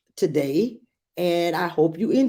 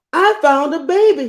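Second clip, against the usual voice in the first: excited high shout; 9 semitones higher, 7 dB louder than usual. Acted. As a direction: call out the news loudly. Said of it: "he shouted".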